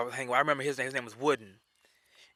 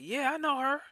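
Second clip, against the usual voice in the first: high-pitched innocence; 13 semitones higher than usual. Retold or imitated. Cheerfully admitting he knows her.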